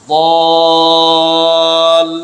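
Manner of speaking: বল stretched into a long sung vowel; long drawn vowel. Shown as a demonstration. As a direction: hold the vowel of বল as a long chant. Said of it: "বলবো এইভাবে".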